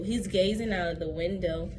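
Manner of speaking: ordinary delivery, nothing marked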